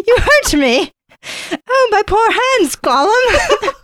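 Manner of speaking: very high, loud mock sobbing; Smeagol voice. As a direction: wail slowly in a hammed-up pitiful squeal. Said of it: "he sobbed in his throat".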